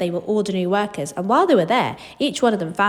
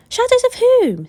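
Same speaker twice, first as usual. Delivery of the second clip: very high, louder eager question; 6 dB louder than usual. Acted. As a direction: blurt it out quickly. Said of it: "said Charlie quickly".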